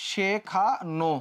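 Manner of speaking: শেখানো slowly split into syllables, closed o at the end; demonstrated pronunciation. Shown as a demonstration. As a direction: sound out শেখানো, she... kha... no. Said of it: "উচ্চারণটা হবে হইতেছে এইরকম".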